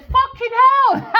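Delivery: high, loud, slow astonished cry; shocked exclamation. Retold or imitated. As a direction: blurt it out stunned. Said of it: "ran back again and said".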